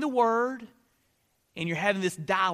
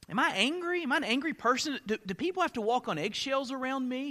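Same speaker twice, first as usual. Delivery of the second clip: questioning oneself; 6 semitones higher, 4 dB quieter than usual. Acted. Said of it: "you're dialoguing with yourself".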